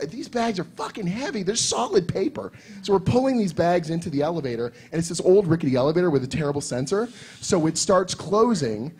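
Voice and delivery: ordinary talk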